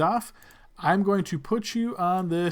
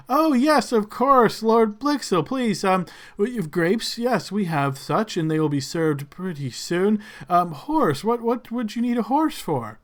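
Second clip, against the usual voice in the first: fawning servant; 4 semitones higher than usual. Acted. Servant fawns over Lord Blixel, high and eager. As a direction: gush over the lord's name.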